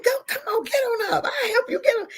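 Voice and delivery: high, giggly retold urging; silly laughing. Woman retelling how she coaxed him up, silly and laughing. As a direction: urge him up through giggles. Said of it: "I'm just as silly".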